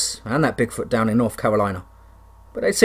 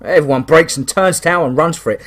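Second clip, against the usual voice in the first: usual speaking voice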